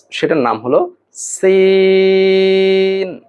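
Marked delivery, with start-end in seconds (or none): 1.1-3.2 সিন with a long, level, higher-held vowel; drawn-out letter name. Shown as a demonstration. Teacher naming the first letter, সিন held long and level. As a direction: hold সিন long on one steady note. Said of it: "আমরা উচ্চারণ করব সিন".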